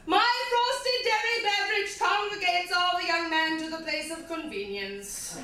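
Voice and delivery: slightly bad British poetry-reading voice; quasi British accent. Comic reads Milkshake as poetry, slightly bad British accent. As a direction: recite it in mock-British English. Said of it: "slightly bad British accent".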